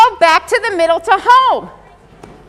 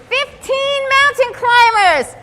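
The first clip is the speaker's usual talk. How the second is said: higher, drawn-out cheer of the number; excited announcement; 6 semitones higher, much slower than usual. Real, not acted. Teacher cheering the number a student picked, excited. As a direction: hype the number up for them.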